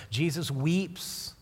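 Jesus weeps drawn out slowly, weeps stretched; slow, solemn "Jesus weeps"; slow emphasis. Real, not acted.

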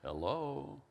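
"Hello" sung out in three gliding tones; imitated father-in-law's three-tone phone "Hello". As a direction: glide "Hello" through three notes. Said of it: "sort of a three-tone hello".

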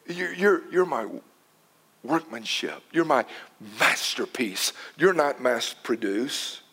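low, soft and tender; quietly tender God voice; gentle voice of God. Acted. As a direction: speak as a loving father. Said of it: "God says".

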